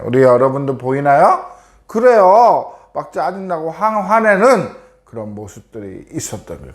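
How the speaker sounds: ordinary delivery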